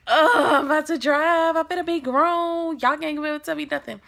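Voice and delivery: high-pitched excited boasting; cocky rush. Retold or imitated. Teen about to drive for the first time, giddy boasting. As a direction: brag like a giddy new driver. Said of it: "felt the rush".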